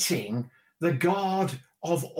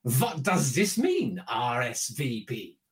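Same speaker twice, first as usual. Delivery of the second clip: put-on puzzled husband with a heavy Eastern European accent; comic Eastern European accent, drawn out; thick Eastern European accent; 5 semitones lower, slower than usual. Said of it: "in his thick Eastern European accent".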